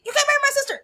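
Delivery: high, hammed-up indignant shout; a mock outraged warning; indignant brother. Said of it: "And so basically".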